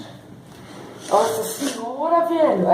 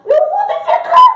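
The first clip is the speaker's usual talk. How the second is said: high yelp; 6 dB louder than usual. Real, not acted. Joking about the burning mask.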